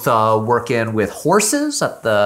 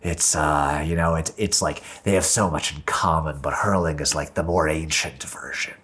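much lower, quiet imitation of dad; low fond take-off of his lacrosse-playing dad; dropped-voice dad impression; imitating dad; 8 semitones lower, 6 dB quieter than usual. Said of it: "he's like".

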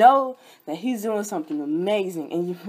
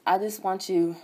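ordinary talk

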